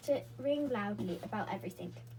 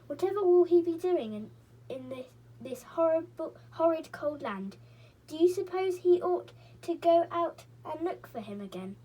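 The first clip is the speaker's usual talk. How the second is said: worried shiver; 7 semitones higher, 6 dB louder than usual. A narrator's reading. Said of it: "shivering as she thought".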